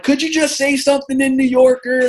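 high, loud imitation of an eager fan; eager stranger. Stranger begging to hear his accent, high eager request. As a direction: pitch it up like an excited stranger asking. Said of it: "And they're like".

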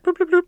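high-pitched mouthed phone bloops; phone dialing beeps. Acted. Calling the Magic Task Force, imitated phone tones.

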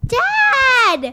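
high, loud annoyed call; shouting dad. Real, not acted. Daughter shouting at her dad to stop.

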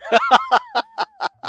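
real laugh; high laugh. Real, not acted. Laughs at his own joke.

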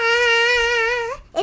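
high hammed-up banshee shriek; mock banshee scream. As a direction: imitate a weak banshee scream. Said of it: "Banshee screams are like".

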